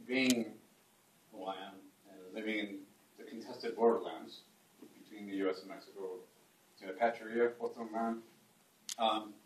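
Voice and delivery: ordinary delivery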